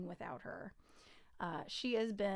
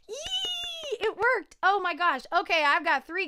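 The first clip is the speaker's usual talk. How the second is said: high, loud happy cry; thrilled cry; 8 semitones higher, 8 dB louder than usual. Real, not acted. Celebrating the new gig, a high loud happy cry. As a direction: burst out with delight.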